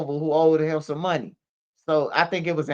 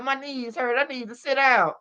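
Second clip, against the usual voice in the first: mock weary mother, pitched up; complaining mama; 6 semitones higher than usual. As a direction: play the frail mom stalling the cops. Mama dodging the police, imitated.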